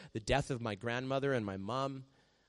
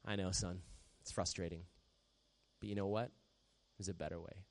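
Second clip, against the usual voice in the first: soft, low fatherly reassurance; a calm father soothing his son; gentle God voice; 5 semitones lower, 10 dB quieter than usual. Pretend. God gently answering a frustrated son, soft and low. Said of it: "And he goes".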